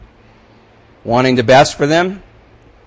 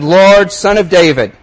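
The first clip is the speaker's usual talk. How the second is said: louder, higher cry for help; shouted plea; 6 semitones higher, 6 dB louder than usual. Acted. Blind men crying out to Jesus, loud plea. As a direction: call out from the roadside to be heard. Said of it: "they shouted".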